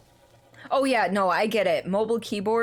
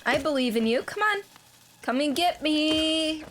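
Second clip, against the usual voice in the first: taunting high; 7 semitones higher than usual. Real, not acted. Taunting an enemy in game.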